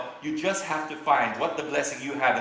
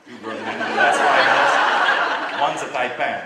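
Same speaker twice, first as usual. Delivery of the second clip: laughing; 9 dB louder than usual. Real, not acted. Laughing at his own predicament.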